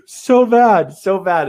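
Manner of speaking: high, loud "so bad" wail; exaggerated wail. Real, not acted. Laughing at his own bad sales record, high wail.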